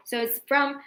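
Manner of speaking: normal talking voice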